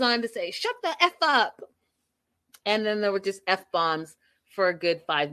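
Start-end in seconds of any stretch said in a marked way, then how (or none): 0.5-1.6 retold screaming. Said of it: "He's screaming at the screen".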